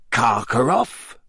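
slow, amused gruff echo; odd laugh in the voice. A narrator's reading. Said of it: "said Moody with an odd laugh".

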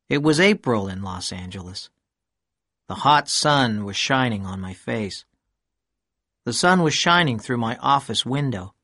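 plain reading voice

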